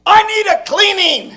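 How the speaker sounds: high, loud pulpit cry; urgent preaching. Retold or imitated. Preacher quotes Brother Branham to the congregation, crying out. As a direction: cry it out to the whole church.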